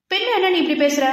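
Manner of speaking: high-pitched, annoyed retort; angry. Acted. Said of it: "பெண் கோபமானாள்".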